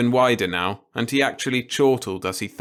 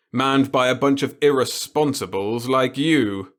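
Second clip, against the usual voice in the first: put-on gleeful old boss; 4 semitones higher, 3 dB louder than usual. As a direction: savour every word of it. The boss lectures.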